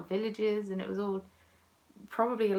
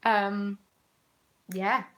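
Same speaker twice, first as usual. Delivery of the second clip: usual speaking voice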